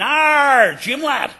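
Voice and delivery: high, loud West Country pirate cry; West Country pirate shout. Pretend. Lecturer mocks the Disney pirate cliché, loud West Country shout. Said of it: "you should shout in a West Country accent".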